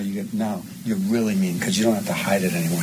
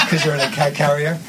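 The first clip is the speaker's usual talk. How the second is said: ordinary talk, nothing marked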